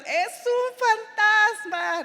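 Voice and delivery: high, slow, loud cry of fright at a ghost; terrified cry. A narrator's reading. Disciples screaming at the figure on the water, terrified cry. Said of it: "o gritaron".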